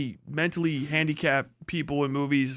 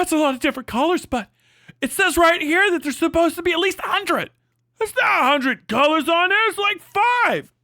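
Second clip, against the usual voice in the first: much higher and louder, manic; mock yelling comedy duo; 10 semitones higher, 4 dB louder than usual. Acting out a movie duo yelling at each other, much higher and louder. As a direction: yell like a dumb comedy movie argument. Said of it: "yelling at each other".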